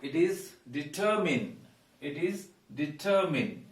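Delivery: 'determine' as 'di-TER-min'; second-syllable stress. Shown as a demonstration. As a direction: lean on 'TER' in 'determine'. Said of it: "we stress the second syllable".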